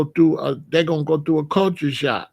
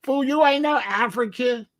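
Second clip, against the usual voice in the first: mocking kid; 8 semitones higher than usual. Kids mocking the newcomer.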